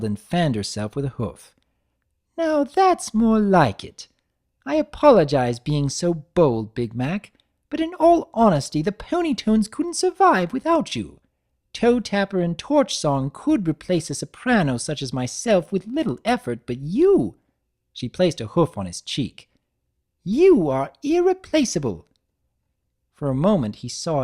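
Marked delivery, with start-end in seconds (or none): 2.4-17.4 higher and louder, flattering diva; high, theatrical refined mare; Rarity voice. Acted. 20.2-22.1 higher and louder, flattering diva; high, theatrical refined mare; Rarity voice. Acted.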